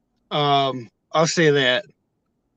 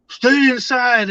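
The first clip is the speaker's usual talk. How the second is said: cop command; 7 semitones higher, slower than usual. Retold or imitated. Cop's command retold.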